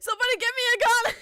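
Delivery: high, loud and fast, laughing through it; hammed-up cry for help between laughs; mock despair.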